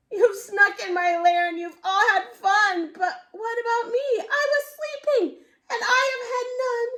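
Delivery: high sobbing bear voice; crying bear. Acted. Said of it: "he whimpers and he moans".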